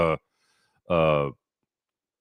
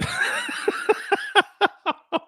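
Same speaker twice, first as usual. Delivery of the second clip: squeaky high-pitched laugh after the bad taste; 6 dB quieter than usual. Real, not acted.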